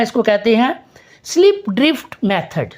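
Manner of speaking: ordinary talk, nothing marked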